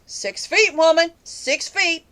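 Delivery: acted-out insistent order to keep back; imitated insistence. Store worker warning a woman to keep back, mock insistence. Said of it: "he looks at me like".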